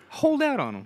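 high, loud imitation of a ranter; mock-emphatic advice; hammed-up urging. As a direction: push it out high and insistent. Retelling comedian's advice, hammed up.

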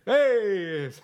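loud high cheer; a big joyful yell; excited shout. Real, not acted. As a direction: shout it back happily.

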